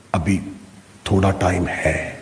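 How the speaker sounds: low, soft voice of temptation; tempting inner voice. Acted. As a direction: quietly lull the sleeper, pitched low. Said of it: "دوسری آواز آئی".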